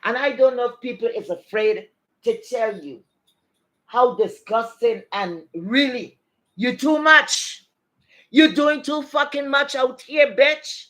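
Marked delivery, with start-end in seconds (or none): 3.9-10.9 raised, slow, emphatic telling-off; angry scolding. Real, not acted. Telling off Kwan Kwan for doing too much, angry scolding. Ranting directly at the woman.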